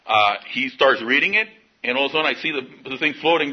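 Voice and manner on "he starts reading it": ordinary delivery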